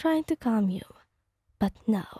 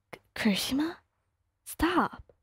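flustered whisper; 5 semitones higher than usual. Acted. Flustered reader stammers.

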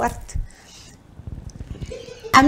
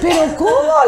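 high-pitched incredulous question; retold baffled reaction; acted disbelief; 8 semitones higher, 3 dB louder, slower than usual. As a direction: exclaim it in comic astonishment. Her astonished reply to Felicia.

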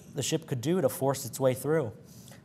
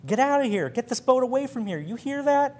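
much higher, louder bawling; bawled; 11 semitones higher, 3 dB louder than usual. A narrator's reading. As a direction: scream it up at the bridge. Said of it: "he bawled at the skipper".

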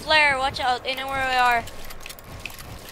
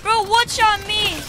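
panicking gamer; 7 semitones higher, 5 dB louder, slower than usual. Real, not acted. Just got shot in game.

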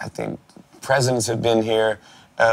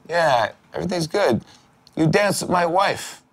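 mock menacing owner; 7 semitones higher than usual. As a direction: drag out the accusation like a mock mob boss. Owner confronts him over coffee.

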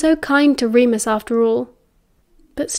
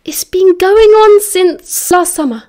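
loud, raised admission; urgent confession; 8 semitones higher, 7 dB louder, slower than usual. A narrator's reading. Sirius keen to tell the truth now it's out, urgent. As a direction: blurt it out high and forceful. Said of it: "Sirius said quickly, keen to tell the truth".

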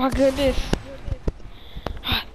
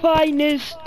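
slow, louder mock announcer; 3 semitones higher, 3 dB louder, slower than usual. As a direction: proclaim it like an ad voice. Slow put-on announcer line.

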